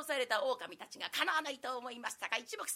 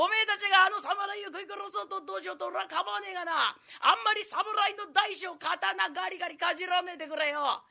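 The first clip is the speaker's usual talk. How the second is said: louder, jeering commoner calling out; jeering rough townsman; 3 semitones higher, 8 dB louder than usual. Acted. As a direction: bellow down from the cliff ledge. Onlooker shouting at wolves.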